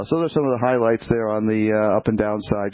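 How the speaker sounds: ordinary talk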